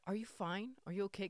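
high, soft fussing; mock concerned manager. Manager checking on employee who called off, mock concern. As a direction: ask high and softly with put-on concern. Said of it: "And she was like".

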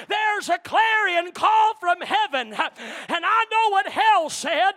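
loud preacher's build, slowing at the peak; preaching at full force. Acted. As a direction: preach it out to the church.

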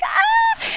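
sudden high-pitched shriek. Retold or imitated. Daughter startled by mom's spoon, high shriek. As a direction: yelp it up high like a startled teen.

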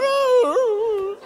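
high, loud mock crying wail; drawn-out hammed-up sob; wailing groan. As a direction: wail like a busted driver. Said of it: "And you're going".

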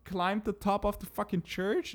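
high, incredulous in-law voice; imitating shocked in-laws; mock disbelief. In-laws shocked she never climbed the church, incredulous.

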